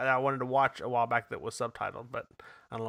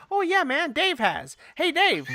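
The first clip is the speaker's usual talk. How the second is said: high-pitched, casual character voice; Korg; 14 semitones higher, slower than usual. Retold or imitated. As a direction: do a high, easygoing rock-guy impression. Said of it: "He's like".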